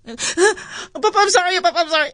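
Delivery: high, pleading imitation of a crying girl; mock crying sister. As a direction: lightly imitate a sobbing plea. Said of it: "And Merafi starts crying".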